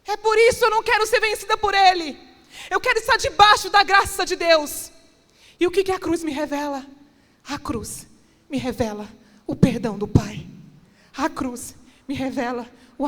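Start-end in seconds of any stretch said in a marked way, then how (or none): 0.0-6.8 high, loud and ringing, climbing toward the top; full-force preaching. Acted. Preacher voicing Paul's forgiveness to the church, loud. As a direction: thunder it out like a revival sermon.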